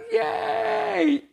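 very high squealed yay; cheering crowd. Pretend. Teacher voicing the festival crowd cheering. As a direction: shriek it like a thrilled crowd.

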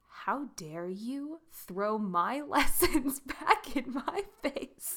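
slow, laughing fake outrage; a hammed-up how-dare-you through laughter; mock indignation. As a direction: play offended while laughing at yourself. Mock outrage at fiance's advice.

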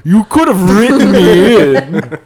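slow, drawn-out villainous complaint; hammed-up, slow character voice; Madara voice. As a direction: stretch out every word as a wronged anime villain. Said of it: "Sorry, I'm not doing the Madara voice".

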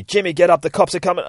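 high, loud acted alarm; urgent warning shout. Junkie running home to warn partner of police, urgent shout.

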